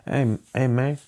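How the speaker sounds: trying out a mock East LA greeting; East LA accent. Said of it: "like, East L A".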